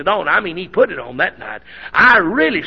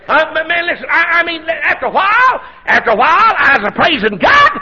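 preacher building up steam, higher and louder; rising preaching; 8 semitones higher, 3 dB louder than usual. Acted. Preacher to congregation, working up to full force. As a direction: climb into the shout of the sermon.

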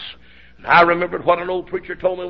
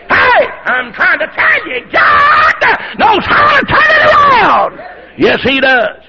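high, loud, ringing preacher shout; full-force preaching; 13 semitones higher, 5 dB louder, slower than usual. Real, not acted. Preacher shouting joy to the church. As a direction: preach it with everything.

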